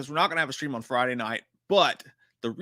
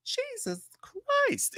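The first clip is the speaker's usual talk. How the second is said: drawn out slowly, much higher; stunned disbelief; 3 dB quieter, much slower than usual. Retold or imitated. Streamer reacting to the kid's line, slow appalled gasp. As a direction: let it sag out in appalled awe. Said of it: "And I'm like".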